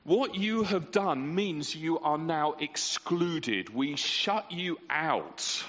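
a city's slow, harsh sentence of exclusion; stern verdict. Retold or imitated. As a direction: condemn them slowly and coldly. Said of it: "It was a way of saying".

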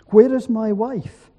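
understated choked-up desperation, voice lifted; choked cry. A narrator's reading. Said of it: "he choked".

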